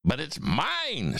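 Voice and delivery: high, drawn-out protest; mock scream. A narrator's reading. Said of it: "screamed the bird".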